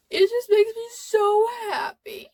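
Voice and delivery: overwhelmed with happy tears, voice breaking high; real happy sobbing; crying while laughing. Real, not acted. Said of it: "I am tearing up right now".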